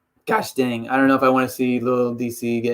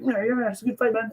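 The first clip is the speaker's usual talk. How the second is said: high, soft, cat-mouthed voice; Stipe; 7 semitones higher, 6 dB quieter than usual. Retold or imitated. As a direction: imitate an overly nice fighter, small and high. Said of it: "little cat-like mouth".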